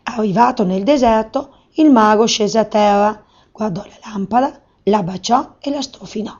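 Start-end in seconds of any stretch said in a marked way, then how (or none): none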